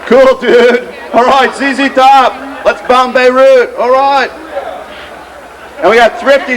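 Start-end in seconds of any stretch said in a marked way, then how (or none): 0.1-4.3 drawn-out mock dude voice; slow, whooping dude impression; rowdy dude. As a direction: stretch it out like a rock-fan dude. Acting out a rowdy rock dude.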